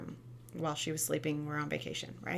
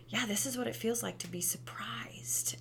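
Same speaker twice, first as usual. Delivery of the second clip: softer, hushed teaching voice; soft whisper; 4 semitones higher, 6 dB quieter than usual. Retold or imitated. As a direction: whisper it to a child at the chart. Teaching child from emotion chart.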